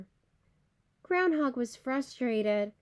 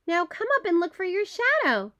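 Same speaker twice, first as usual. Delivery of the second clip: shouted call; 5 semitones higher, 3 dB louder than usual. A narrator's reading. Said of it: "Groundhog shouted".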